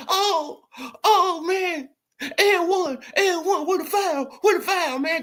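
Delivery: high-pitched mocking whine with laughter; mock complaining player. Mocking NBA players who beg the refs for a call, high laughing whine. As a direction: ham up a player begging the ref for the foul. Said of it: "they yelling and screaming".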